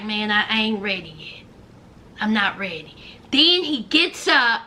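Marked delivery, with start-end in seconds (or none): 0.0-3.0 low, quick, withdrawn refusal; reluctant mumble. Retold or imitated. Imitating Arthur telling Hope he isn't ready, low and withdrawn. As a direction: pull back and mumble it away.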